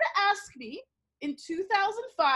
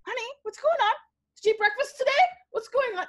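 high-pitched, coaxing teacher voice; high voice for checking on an upset pupil; concerned teacher; 8 semitones higher, 3 dB louder, faster than usual. Retold or imitated. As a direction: question a child in a raised, concerned pitch.

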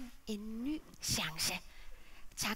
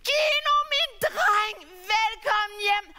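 much higher, louder, slow cry of welcome; father overjoyed at the return; joyful welcome; 9 semitones higher, 10 dB louder, slower than usual. Acted. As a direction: welcome your lost son home.

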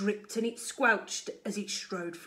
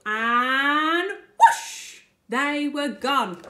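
big whoosh; 5 semitones higher, 7 dB louder, much slower than usual. A narrator's reading. Story climax.

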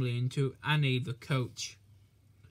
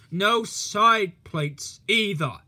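loud, slow, high reproach; stern scolding; 4 semitones higher, 7 dB louder, much slower than usual. Acted. Policeman inspects Thomas's wheels, stern and loud. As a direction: tick off the fault sternly.

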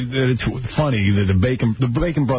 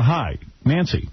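usual speaking voice

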